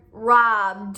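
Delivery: "robbed" stretched slowly to a voiced d; slow "robbed", let the d sound; d ending. Shown as a demonstration. Teacher asking learners to hear d, slow "robbed" with voiced ending. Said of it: "it's going to say d".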